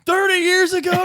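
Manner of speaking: put-on high, loud, laughing take on an old wrestler; mock old-timer voice, high and loud through laughter; aging wrestler. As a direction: ham up a washed-up star reminiscing. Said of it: "And he's just like".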